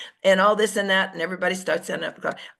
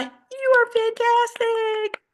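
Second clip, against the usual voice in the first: high, slow, stretched "fantastic"; high drawn-out gush of praise; 14 semitones higher, slower than usual. Shown as a demonstration. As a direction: gush it like a fan. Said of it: "but an I".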